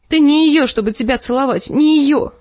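выше и громче, с надрывом; выкрикнуть ревность; истеричный крик. A narrator's reading. Лиза кричит в ревности. (Лиза кричит Петиру в ревности)

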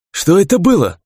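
high-pitched, startled demand; agitated question. A narrator's reading. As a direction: blurt it out in alarm. Shaken man exclaims after the attack.

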